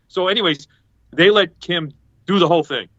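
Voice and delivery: ordinary delivery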